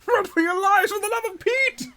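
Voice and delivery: high, loud comic cry of alarm; mock panic scream. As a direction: scream a hammed-up warning to flee. Mock panicked beach evacuation cry.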